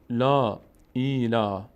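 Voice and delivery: "لا، ای، لا" slowly, each vowel held long; long vowels, syllable by syllable. Shown as a demonstration. Teacher sounding out syllables of a verse, slow long vowels. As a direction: spell out "لا، ای، لا" with drawn-out aa and ee.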